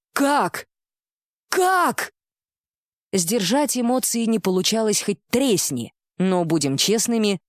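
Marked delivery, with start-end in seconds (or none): 0.1-2.1 high, incredulous repeated question; disbelieving. Acted. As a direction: blurt it out unable to believe. Disbelief bursting out again.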